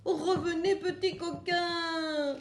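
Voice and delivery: half-laughing hen calling her eggs back; mock grumble, giggling; laughing scold. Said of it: "Elle râle et s'empresse".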